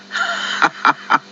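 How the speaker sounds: high gleeful chuckle. Acted. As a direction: chuckle at a filthy friend. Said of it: "chuckled Percy".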